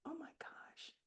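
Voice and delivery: breathless whispered oh my gosh; hushed, stunned whisper; whispered realization. Retold or imitated. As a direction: let it slip out as a stunned whisper.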